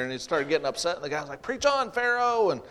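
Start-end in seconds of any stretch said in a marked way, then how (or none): 1.4-2.5 high, drawn-out heckling cry; visitor's call-out. Retold or imitated. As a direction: imitate a back-row amen shouter, pitched way up. Visitor calls out once more.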